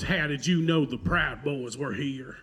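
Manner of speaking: slow, mock Southern drawl; fake Southern accent. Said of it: "The fake Southern accent".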